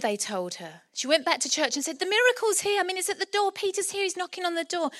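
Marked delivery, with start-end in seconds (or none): 2.0-4.9 a touch of breathless-news urgency, pitched up; overjoyed. Acted. Rhoda tells the church Peter is here, overjoyed. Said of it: "she was so overjoyed".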